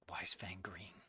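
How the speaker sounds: very soft, whispered to oneself; barely audible, whispered question; baffled whisper. Real, not acted. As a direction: whisper it in weary bewilderment. Muttering to self, baffled.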